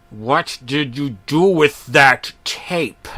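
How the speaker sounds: slow, angry, stammering demand. Acted. Fuming boss demanding the missing tape. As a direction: press him for the tape, furious. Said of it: "he said angrily, stammering".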